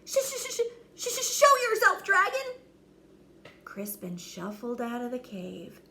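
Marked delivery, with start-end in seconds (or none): 0.0-2.6 very high stammering knight; terrified bravado; scared stutter. Acted.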